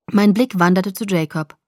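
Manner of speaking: plain reading voice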